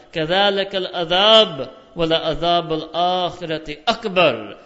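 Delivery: loud, fast chanted Quran verse; Quran recitation. Acted.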